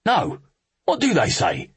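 high-pitched, curious soldier voice; eager soldier. Acted. Soldier prompts the officer for the joke, eagerly.

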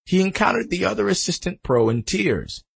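plain reading voice

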